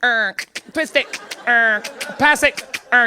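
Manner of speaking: slow, separated robotic commands; imitated game toy with buzzer noises. Comic mimics Bop It calling moves, slow mechanical commands. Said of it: "It was like".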